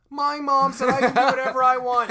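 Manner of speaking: very loud high voice, short of falsetto; high, blaring man-child boast; super high-pitched voice. Shown as a demonstration. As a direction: belt it high in full voice. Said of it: "super high-pitched voice, but it's just not, like, falsetto".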